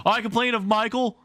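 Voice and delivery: high, loud tattletale; tattling child. Acted. Curly tells on Michael, high and loud. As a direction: tell on him instantly.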